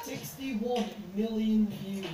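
drawn-out disbelief; slow, stunned. Real, not acted. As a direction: let it sink in slowly. Stunned at view count.

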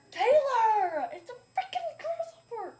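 high, loud half-laughing shriek; scared yell. Real, not acted. Scared of grasshopper.

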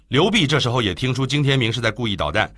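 ordinary delivery

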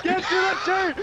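high excited yelling. Real, not acted. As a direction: yell at them to hurry. Urging rival in race.

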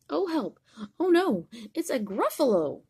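high, loud pretend alarm; mock fright. Grandma plays scared for the grandchild, high mock alarm. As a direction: squeal in pretend panic. Reader acts fright at reveal.

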